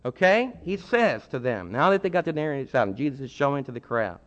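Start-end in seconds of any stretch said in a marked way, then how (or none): none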